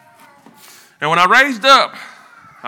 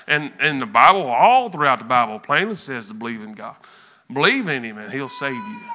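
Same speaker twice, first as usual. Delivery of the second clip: normal talking voice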